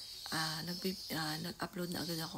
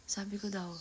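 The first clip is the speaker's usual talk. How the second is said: ordinary talk, nothing marked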